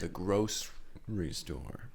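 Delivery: low, slow dramatic intoning; slow ominous repeat. Acted. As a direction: say it like a horror trailer. Mock-spooky repeat of the place.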